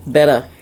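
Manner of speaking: "Betta" said BET-uh, short e; corrected pronunciation. Real, not acted. Fishkeeper correcting own slip, "Betta" as BET-uh. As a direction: say "Betta" to rhyme with "better". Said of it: "It's actually pronounced betta".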